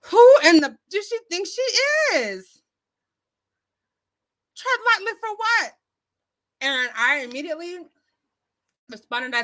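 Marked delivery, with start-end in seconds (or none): 0.1-5.7 high-pitched retold outrage; instantly turned, indignant. Retelling her reaction to a commenter's warning, indignant. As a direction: act out being set off, pitch jumping up. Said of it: "just instantly turns you and you're like".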